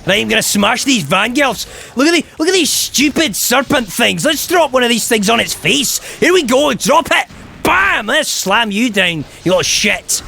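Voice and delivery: high, excitable Scottish gamer yelling; Scottish accent. Acted. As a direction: rant through the fight in a Scottish accent. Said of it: "Let's Play of this with a Scottish accent".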